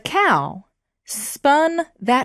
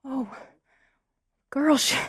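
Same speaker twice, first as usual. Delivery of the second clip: ordinary delivery, nothing marked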